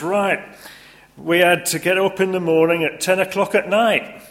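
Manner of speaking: very high, loud topping Yorkshireman; Yorkshire accent. Retold or imitated. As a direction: outdo everyone in broad Yorkshire. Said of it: "the next person says".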